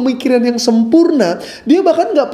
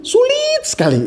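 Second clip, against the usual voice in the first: mock exasperation. Exaggerating adult disbelief.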